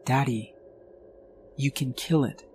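lightly voiced child urging her dad; young daughter.

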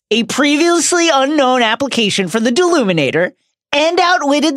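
rising to high, loud praise; building excitement. Pretend. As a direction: hype him up grandly.

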